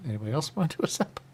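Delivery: quick vendor call. Retold or imitated. Vendor hawking samples.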